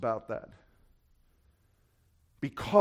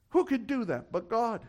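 higher, more pointed repeat; raised rhetorical question; 7 semitones higher than usual. Real, not acted. Preacher pressing the question on the congregation, raised pitch.

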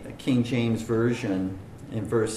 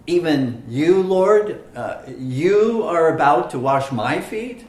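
higher, louder incredulous question; emphatic disbelief; 5 semitones higher, 6 dB louder than usual. Shown as a demonstration. Preacher voices Peter's amazement that the Lord would wash his feet, emphatic. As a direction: hit "you" and "Lord" in astonishment. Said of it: "That's emphatic".

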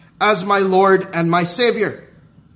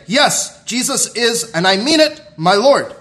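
higher, punchy declaration; emphatic profession; 6 semitones higher, 4 dB louder than usual. Acted. Preacher voicing believers who profess Jesus as Lord, emphatic. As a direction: insist on it, with "Lord" hit loud at the end. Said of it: "some of us who emphatically say".

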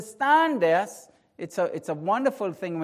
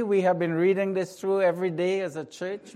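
usual speaking voice

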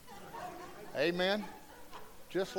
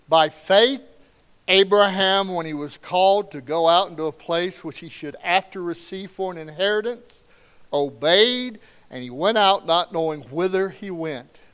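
loud, measured Bible reading; scripture reading; 8 dB louder than usual. Acted. Pastor reading Hebrews eleven to the congregation, loud and proclaiming. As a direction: proclaim each verse to the room.

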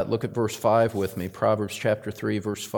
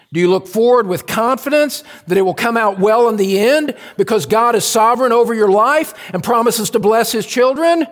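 high, building pulpit challenge; ringing, insistent sermon delivery; impassioned preaching; 9 semitones higher, 5 dB louder than usual. Real, not acted. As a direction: drive the question upward with fervor. Preaches at full force.

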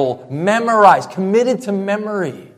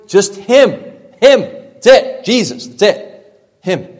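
rising preaching; 4 semitones higher, 5 dB louder than usual. Real, not acted. Building climax of sermon.

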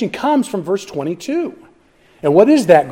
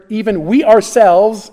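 "we ourselves" slow and raised; stress on OURSELVES; 5 semitones higher, 4 dB louder than usual. Shown as a demonstration. Preacher stressing the repeated pronoun in the verse, emphatic ourselves. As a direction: stretch "we ourselves" for emphasis, pitched up.

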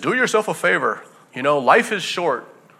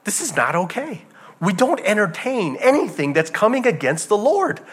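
normal talking voice

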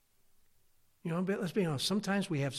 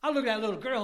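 ordinary talk

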